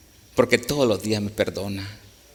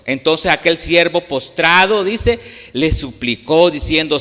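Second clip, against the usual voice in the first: scripture reading; 2 semitones higher, 5 dB louder than usual. Acted. Preacher reading the parable aloud, louder and proclaiming. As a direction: proclaim the verse to the congregation.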